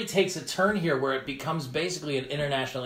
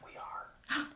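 whispered; 11 dB quieter than usual. Real, not acted. Joking reply in cave echo.

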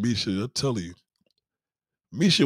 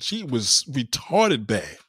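ordinary talk, nothing marked

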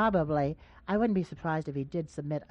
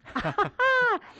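hearty laugh; 4 dB louder than usual. Real, not acted. Host amused by caller's joke.

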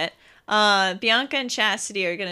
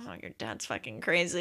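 lower, much softer muttered aside; low mock aside; 9 semitones lower, 11 dB quieter than usual. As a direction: say it under your breath to a friend. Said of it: "She's over here like".

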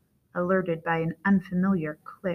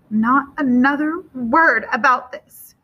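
slow, loud maternal warning; raised, forceful command; fierce, terrible voice; 6 semitones higher, 8 dB louder than usual. A narrator's reading. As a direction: forbid it fiercely, each word weighted. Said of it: "in a fierce and terrible voice".

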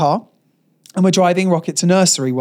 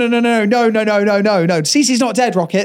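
higher, repeated hurried noes; mock panicked backtracking; 4 semitones higher than usual. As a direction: rush to undo a joke gone wrong. Parents hastily correcting the toddler.

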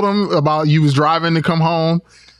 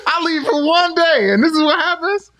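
laughing disbelief. Real, not acted. Laughing disbelief at friend's story.

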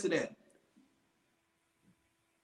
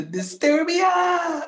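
excited shout; 12 semitones higher, much slower than usual. Real, not acted. Hyped song recommendation.

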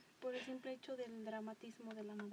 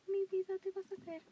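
higher doting coo, loud start; doting mom; 6 semitones higher, 5 dB louder than usual. Retold or imitated. Mom warning her child it will fall, doting coo.

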